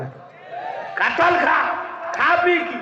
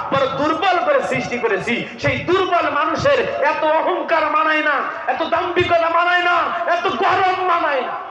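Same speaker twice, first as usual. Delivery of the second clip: a preacher scolding the proud, building to a shout; fiery preaching; 8 semitones higher than usual. Acted. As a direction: thunder against pride. Preaching at full force.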